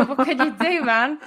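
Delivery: high, laughing delivery; amused. Retold or imitated. Telling the disappointed suitor tough luck, laughing. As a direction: toss it out through a laugh. Laughing at her own retold reply.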